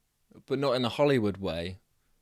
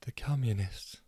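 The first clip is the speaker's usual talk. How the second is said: much softer, small voice; barely heard; 6 dB quieter than usual. A narrator's reading. Debbie answering the noisy crowd, too quiet. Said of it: "but nobody could hear".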